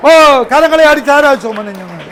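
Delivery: loud, high Pentecostal prayer with tongues; ecstatic prayer. Acted. Preacher praying over the town in tongues, loud. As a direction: pray it out in full fervour.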